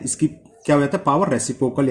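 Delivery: normal talking voice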